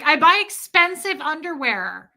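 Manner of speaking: high-pitched, hammed-up indignant protest; mock indignation. Comedian defending costly underwear to partner, mock indignant. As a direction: push it high, as if outraged. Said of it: "I was like".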